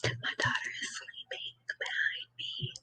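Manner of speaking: barely audible whisper. Real, not acted. Whispers as child sleeps.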